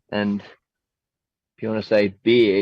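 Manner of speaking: ordinary delivery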